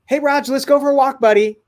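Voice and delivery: high-pitched, eager dad call; upbeat invitation. Retold or imitated. Dad inviting his young son for a walk, upbeat. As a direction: coax a kid along cheerily.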